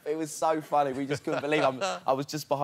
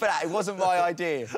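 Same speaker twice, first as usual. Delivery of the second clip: ordinary delivery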